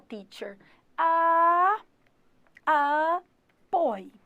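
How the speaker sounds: ordinary talk, nothing marked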